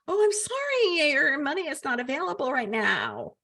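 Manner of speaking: singsong mock customer-service apology; mock apologetic clerk. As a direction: put on a high-pitched, falsely sorry official voice. Mock bank refusing a customer.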